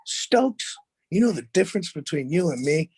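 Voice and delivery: put-on high, smug teen voice; cocky comeback. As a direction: set up the punchline to the coach. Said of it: "And I go".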